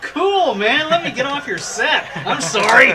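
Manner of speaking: high, loud, laughing mock cop voice; laughing cop impression. Retelling a cop who backs off from a film crew, laughing mock cop voice. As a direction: ham up the apologetic cop while cracking up. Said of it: "he goes".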